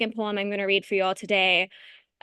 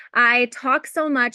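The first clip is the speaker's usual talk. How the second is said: ordinary talk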